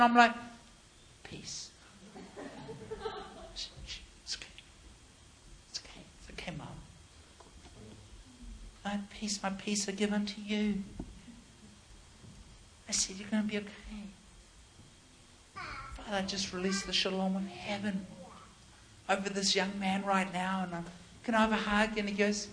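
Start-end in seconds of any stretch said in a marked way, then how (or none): 1.2-10.8 hushed, near-whispered reassurance; calm them very softly, with pauses; soothing. Retold or imitated. Preacher calming the crying mum, hushed and soothing. 13.2-22.0 gentle prayer. Retold or imitated. Preacher praying over the young man, gently and softly.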